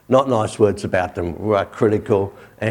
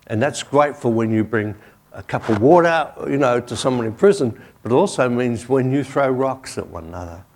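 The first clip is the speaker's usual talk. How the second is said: ordinary talk, nothing marked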